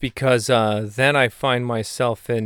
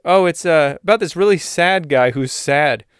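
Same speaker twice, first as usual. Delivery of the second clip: higher, louder put-on friend voice; a hint of a bored pal explaining; Salamander's voice; 5 semitones higher, 5 dB louder than usual. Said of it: "He says".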